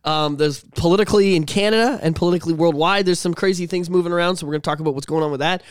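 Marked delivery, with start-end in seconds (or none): none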